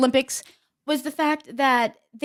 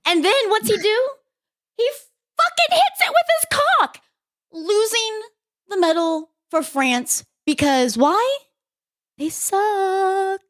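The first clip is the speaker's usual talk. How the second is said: much higher, louder mock-outraged rant; pitched-up excited storytelling; incredulous rant; 10 semitones higher, 3 dB louder than usual. Real, not acted. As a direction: climb into disbelief at the blunder. Outraged retelling of the fail.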